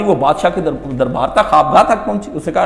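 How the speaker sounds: usual speaking voice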